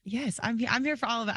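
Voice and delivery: ordinary talk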